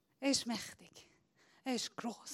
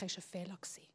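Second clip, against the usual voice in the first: whisper; 7 semitones lower, 15 dB quieter, much faster than usual. Acted. Whispered aside.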